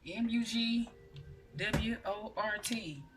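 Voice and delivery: letter by letter, slow; spelling it out. Shown as a demonstration. Spelling the herb's name for viewers, slow and deliberate.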